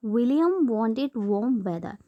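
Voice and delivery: William wanted warm weather, each W stressed and drawn out slowly; slow teacher demo, hitting the W in William wanted warm weather; alliteration demo. Shown as a demonstration. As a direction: say it slowly, leaning on every W. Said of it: "we are seeing the W".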